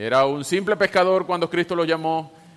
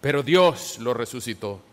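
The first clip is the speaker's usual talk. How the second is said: raised, ringing public reading of a sermon; proclaimed scripture reading; 3 semitones higher than usual. Acted. Preacher reading Peter's first sermon to the congregation, declaimed with force. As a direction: proclaim it to a crowd, lifting the pitch.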